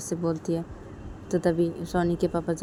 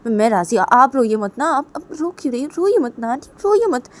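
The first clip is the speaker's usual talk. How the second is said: put-on flustered young woman soothing a crying aunt; anxious consoler; 5 semitones higher, 7 dB louder than usual. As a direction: hurriedly reassure and give in, repeating yourself. Voices young woman consoling, agreeing to marry. (घबराकर दिलासा देना)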